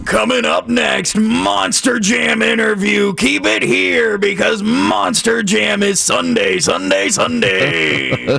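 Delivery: booming monster truck announcer; Monster Jam voice. Pretend. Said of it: "you wanna do your Monster Jam voice".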